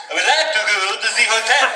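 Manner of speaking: high, fast hammed-up French accent; a tourist faking a French accent, badly; mock French accent, said fast and high; fake French accent. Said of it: "you adopt a French accent".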